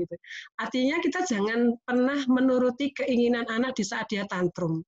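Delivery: normal talking voice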